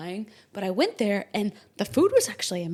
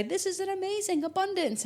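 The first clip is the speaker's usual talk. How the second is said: mock astrologer; 10 semitones higher than usual. Mimicking astrologers' glowing claims.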